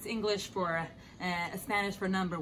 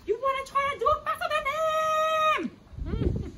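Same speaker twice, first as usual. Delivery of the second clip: excited shout. Acted. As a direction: belt it out to fire them up. Urging kids to beat competitors.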